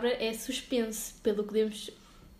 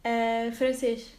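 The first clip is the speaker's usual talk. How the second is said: slow, stretched Ah, francês; drawn-out francês; much slower than usual. Pretend. Teasing her friend about French, drawn out slowly. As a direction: savour the word francês slowly.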